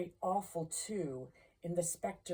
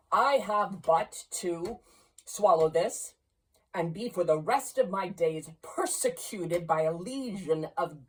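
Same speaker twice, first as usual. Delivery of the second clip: loud, scornful miser spitting out humbug; Scrooge's defiance; 6 dB louder than usual. Acted. Scrooge defying the ghost with humbug, loud scorn. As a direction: blast it out to drown your fear.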